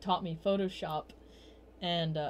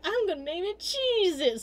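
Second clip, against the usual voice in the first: much higher goofy mock voice; goofy drunk friend; 11 semitones higher than usual. Retelling drunk friend naming his Golduck, high goofy mock voice. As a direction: ham up the drunk guy's proud announcement.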